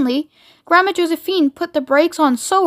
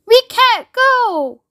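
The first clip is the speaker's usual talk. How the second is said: high, very drawn-out wail; 10 semitones higher, 4 dB louder, much slower than usual. A narrator's reading. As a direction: wail in despair, stretching each word. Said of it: "wailed Grandma Georgina".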